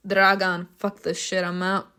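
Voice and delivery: normal talking voice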